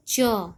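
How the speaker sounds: च said alone with its अ vowel sounded; audible final अ. Shown as a demonstration. Teacher showing children the vowel inside च, sounding it out. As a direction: sound out च, ending clearly on अ. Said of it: "last में उच्चारण करने पे अ का स्वर".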